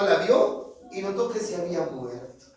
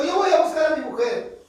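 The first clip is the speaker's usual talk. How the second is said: higher, louder insolent declaration; defiant boast; 7 semitones higher, 4 dB louder than usual. Acted. The insolent man insists on fetching his woman. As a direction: swagger as you say it.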